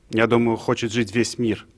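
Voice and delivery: ordinary delivery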